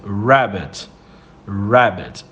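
Rabbit with a curled, natural English r; English rabbit with curled tongue. Shown as a demonstration. Teacher asking students to practise, low and relaxed. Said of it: "dobra a sua língua pra falar".

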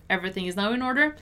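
fast, linked-up read; conversational-speed model sentence. Shown as a demonstration. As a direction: say it briskly with a rising end. Teacher repeats at quicker pace.

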